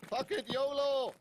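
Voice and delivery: reckless yell. Real, not acted. Player decides to rush.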